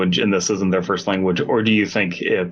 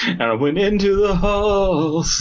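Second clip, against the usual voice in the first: hammy hero; 9 semitones higher than usual. Pretend. Hammy game-hero narration.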